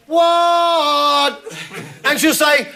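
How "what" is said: high, stretched-out answering call; shouted reply. Retold or imitated. Man answering partner from another room, high drawn-out shout. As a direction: call back across the house. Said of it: "be a lovely bug and go".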